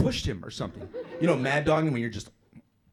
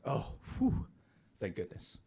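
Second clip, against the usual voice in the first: hushed sigh of relief; acted relief; 6 dB quieter, slower than usual. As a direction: let the tension out softly. Retold relief at safe step.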